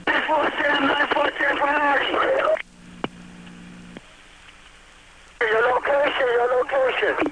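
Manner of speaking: high, strained urgent radio call; urgent, frantic. Real, not acted. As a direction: push the message out in alarm. Urgent priority call after collapse.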